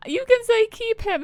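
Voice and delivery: high, loud burst of delight; excited outburst. Real, not acted. Streamer urging chat to choose keep him, delighted. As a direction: blurt it out gleefully.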